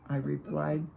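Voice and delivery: usual speaking voice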